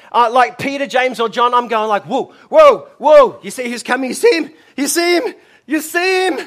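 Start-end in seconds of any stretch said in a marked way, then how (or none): 2.0-6.4 shouting in excitement, rising high; excited disciples. Acted. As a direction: call out amazed as if Jesus is coming. Acting excited disciples seeing Jesus.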